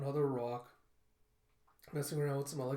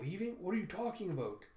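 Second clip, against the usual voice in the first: higher-pitched, incredulous question; startled disbelief; 5 semitones higher than usual. Acted. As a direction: jump up in pitch, caught off guard by the news. Girl startled by news of leaving.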